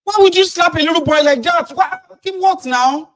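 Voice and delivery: very high, loud protest, slowing at the end; shrill hammed-up protest, very high; mock outraged crowd voice. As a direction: squeal in fake outrage at the slap. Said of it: "And the boy is going".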